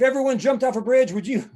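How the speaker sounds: lightly hammed-up scolding parent; parent's stock scolding. Acting out a parent saying.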